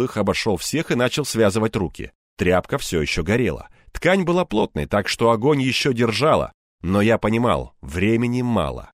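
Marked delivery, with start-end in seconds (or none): none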